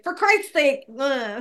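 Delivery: overdone Jersey accent, as mockery. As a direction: lightly imitate a bad Jersey accent. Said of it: "told him about what a Jersey accent sounded like".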